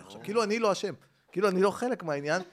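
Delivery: usual speaking voice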